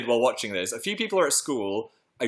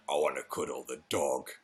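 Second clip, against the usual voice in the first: much lower and softer, deliberately deep; deep serious voice; 10 semitones lower, 9 dB quieter than usual. Shown as a demonstration. Correcting himself after squealing, low and deep. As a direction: drop to a low, grave tone for "I wanna cuddle the dog". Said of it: "That's how I should have said it".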